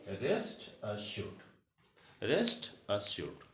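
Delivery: "rest assured" drawn out very slowly, stress on rest; very slow model phrase. Shown as a demonstration. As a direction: stretch "rest assured" for the class to repeat. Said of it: "भरोसा रखें". ("rest assured" बहुत धीरे, rest पर ज़ोर)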